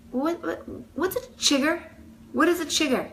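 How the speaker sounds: high, halting disbelief; baffled questioning. Retold or imitated. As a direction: ask it high, as if stunned. Baffled reaction to diagnosis, retold.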